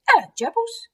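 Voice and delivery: put-on high, squeaky little child voice; two-year-old boy. Eddie greeting gerbils in their cage, toddler voice. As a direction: chirp it like a toddler greeting pets. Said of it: "suddenly I hear".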